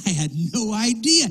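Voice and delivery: mock astonishment. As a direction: pretend to be floored by news. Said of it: "He doesn't go".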